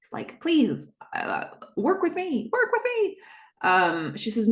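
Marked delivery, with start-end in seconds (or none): 0.4-3.2 pleading. Retold or imitated. Joe begs Cam for help.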